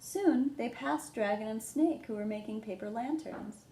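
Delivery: ordinary delivery, nothing marked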